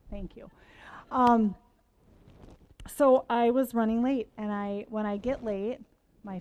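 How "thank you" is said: quick hushed thank you; whispered thanks. Real, not acted. Speaker whispering thanks to a friend in the audience, hushed. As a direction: murmur it under your breath.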